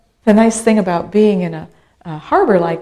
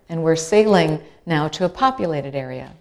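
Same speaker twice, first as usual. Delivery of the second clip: normal talking voice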